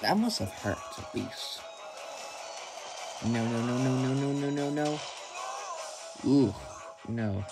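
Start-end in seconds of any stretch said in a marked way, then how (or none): none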